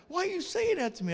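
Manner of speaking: high-pitched, taken-aback protest; wounded protest. Retold or imitated. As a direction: protest as if unfairly accused. Rebuked man protests in retold exchange.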